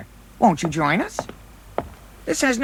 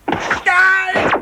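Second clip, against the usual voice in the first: battle cry; 6 semitones higher than usual. Acted. Charging into a bar brawl.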